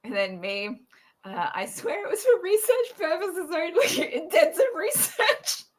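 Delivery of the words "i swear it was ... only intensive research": high-pitched excuse through laughter; laughing protest. Acted. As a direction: plead mock innocence while cracking up. Acting out own joking excuse.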